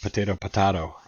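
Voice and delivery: potato as "potayto", then potato as "potahto"; dialect contrast. Shown as a demonstration. American summing up dialects, "potato" both ways. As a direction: switch the vowel in "potato, potato" from AY to AH. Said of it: "learn a little more about dialect".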